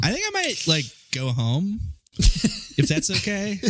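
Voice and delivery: high, hesitant, slow request; mock timid excuse. Friend playing woman wanting to go home, tentative. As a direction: ease out awkwardly, pausing mid-sentence.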